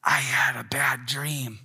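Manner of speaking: put-on slow child's whisper; whispered, slow and small; loud whisper. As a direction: breathe it like a scared little girl at night. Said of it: "a voice loudly whisper in my ear".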